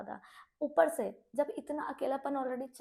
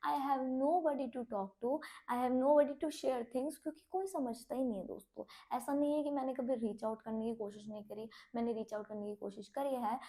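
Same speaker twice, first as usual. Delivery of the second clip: ordinary talk, nothing marked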